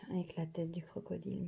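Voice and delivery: soft unvoiced whisper over the card. Real, not acted. Showing tarot cards to viewers, a hushed whisper. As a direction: breathe the words, almost no voice.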